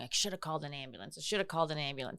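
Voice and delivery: soft, fast muttered regret; lightly voiced mom scolding herself; mom regretting. Mom in the ER hallway, a soft fast regretful repeat. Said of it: "My mom kept saying".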